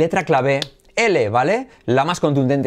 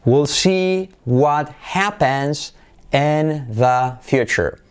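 "We'll see what happens in the future" said very slowly; slow model sentence; much slower than usual. Shown as a demonstration. Teacher models the sentence slowly for learners. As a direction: spell it out word by word. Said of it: "Veremos lo que ocurre".

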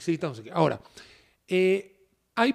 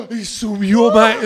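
slow, much louder mock excitement; mocking a crypto fan thrilled by a rise; 10 dB louder, much slower than usual. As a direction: drag it out like a giddy crypto fan.

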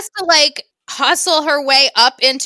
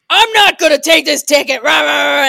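mock angry driver; 2 semitones higher, 5 dB louder, faster than usual. Pulled-over driver yelling at the cop, mock angry. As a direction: shout it like a belligerent driver refusing the ticket. Driver refusing a traffic ticket.

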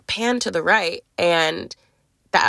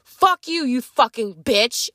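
mock in-your-face insult, pitched high at first; retold angry insult; 4 semitones higher, slower than usual. Girlfriend getting in her face before a shove, retold anger. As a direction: imitate her lashing out, high then dropping. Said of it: "got in my face and was like".

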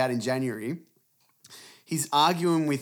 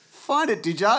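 smug gotcha; 7 semitones higher, 5 dB louder than usual. Retold or imitated. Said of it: "I'm like".

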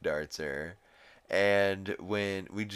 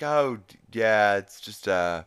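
loud mock panic, stalling; flustered Stewie; 3 semitones higher, 10 dB louder than usual. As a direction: blurt it out like a caught baby. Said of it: "Stewie's like".

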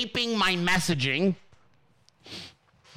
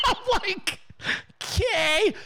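much higher, laughing "Okay"; laughing in stunned agreement; 13 semitones higher, slower than usual. Real, not acted. Answering the staffer while laughing.

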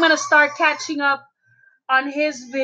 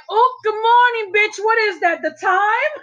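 imitating the funny guy; 6 semitones higher than usual. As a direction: ham it up like the guy at the store. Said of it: "He was like".

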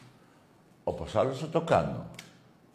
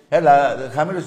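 raised voice; 4 semitones higher, 10 dB louder than usual. Real, not acted. Tells caller to lower voice.

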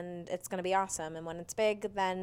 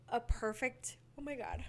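yawning; 4 semitones higher, 6 dB quieter than usual. Real, not acted. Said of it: "I'm yawning".

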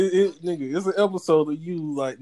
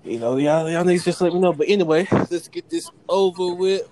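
ordinary delivery

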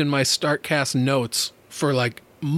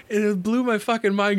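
ordinary delivery, nothing marked

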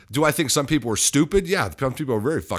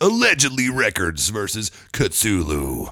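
slow epic announcer read; movie trailer voice; 2 semitones lower, slower than usual. Shown as a demonstration. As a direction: announce the showdown, slowly. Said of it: "the trailer voice".

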